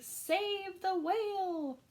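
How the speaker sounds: the trail's message proclaimed; urgent plea. Acted. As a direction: spell out the rescue call. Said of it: "a silvery trail saying".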